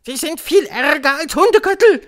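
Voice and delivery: high, loud witch screech; Grand High Witch. A narrator's reading. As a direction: declaim it in the witch's shrill voice. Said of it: "heuchelte die Hoch". (Hexenstimme, hoch und laut)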